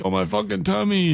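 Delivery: lightly put-on whiny voice; mock bellyache whine. Voices Tom's bellyache complaint.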